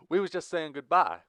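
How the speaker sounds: high, loud interjection; honest, blunt outburst. A narrator's reading. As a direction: cut in forcefully and mean it. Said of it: "intervened with a much more honest tone".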